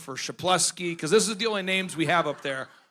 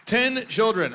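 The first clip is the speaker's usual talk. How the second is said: usual speaking voice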